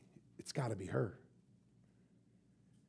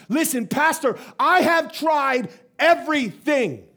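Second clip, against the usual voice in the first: frustrated outburst; 5 semitones higher, 5 dB louder than usual. Retold or imitated. Wife pleads frustrated to pastor.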